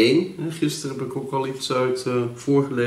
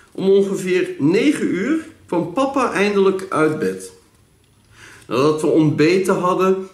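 diary read aloud; 7 semitones higher than usual. Acted. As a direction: read an old diary out with clear, deliberate phrasing.

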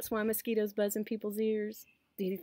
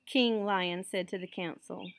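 ordinary delivery

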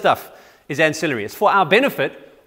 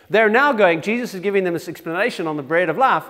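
ordinary talk

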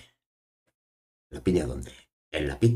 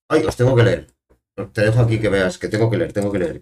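loud, high burst easing down; flustered realisation; 3 semitones higher, 7 dB louder than usual. Real, not acted. Streamer realising there is chat still to read, flustered. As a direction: exclaim it as you catch your own oversight. Suddenly notices unread chat messages.

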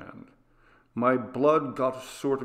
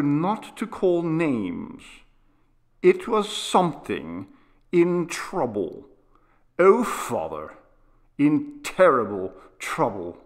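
put-on distressed boy; 5 semitones higher, 4 dB louder than usual. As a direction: plead with growing distress. Boy describes suffering thing.

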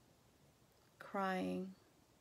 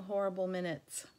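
ordinary delivery, nothing marked

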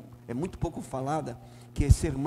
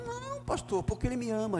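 much higher, lightly mocking voice of a complacent church member; mimicking the counselee; 9 semitones higher than usual. Pastor voicing the man he counselled, high mocking imitation. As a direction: play the smug sinner, pitched way up. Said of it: "E eu disse".

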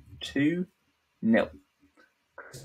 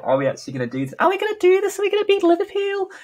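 high squeaky fan voice, much higher and louder; mock Everton fans; 4 dB louder, much faster than usual. Everton fans on tenterhooks in the eighty-ninth minute, squeaky and high. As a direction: squeal like a nervous hopeful fan.

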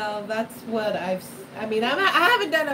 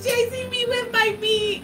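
laughing echo; 12 semitones higher than usual. Real, not acted. Friend echoes the punchline laughing.

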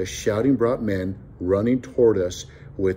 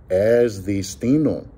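ordinary delivery

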